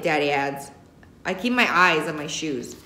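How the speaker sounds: plain reading voice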